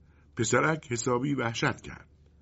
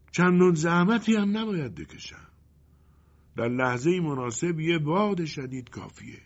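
sly wind character; 7 semitones higher than usual. Acted. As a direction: start raised, then drop confidentially.

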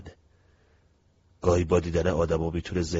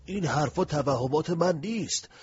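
insistent; 7 semitones higher than usual. Acted. Mino insisting the voice is real.

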